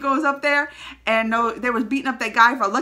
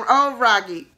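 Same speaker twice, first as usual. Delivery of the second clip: fond laughing oh; 5 dB louder than usual. Real, not acted.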